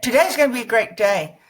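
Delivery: fast, upbeat rattle; quick variation. Shown as a demonstration. Coach showing any way of saying it, quick. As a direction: rattle the sentence off brightly and quickly. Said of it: "I don't mind how you say it".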